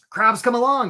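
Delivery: usual speaking voice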